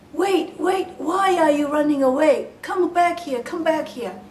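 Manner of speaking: high, loud call after someone running off. Acted. Old woman calls the fleeing girl back, loud and high. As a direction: shout after her to come back.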